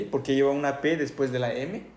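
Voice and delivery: ordinary talk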